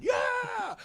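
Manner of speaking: very high, held mock whoop; imitated excited yell; imitated crowd cheer. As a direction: cheer like a whole audience, in falsetto. Everyone cheering him, retold.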